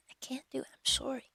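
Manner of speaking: plain reading voice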